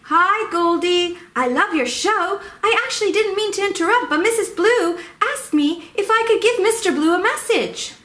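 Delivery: a chirpy little visitor rushing in; Missus Hummingbird. Pretend. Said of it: "Oh, hi, Missus Hummingbird".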